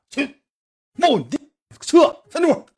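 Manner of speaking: sharp high angry shout; shouting angrily. Retold or imitated. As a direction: shoo him off, loudly. Narrator shoos brother away.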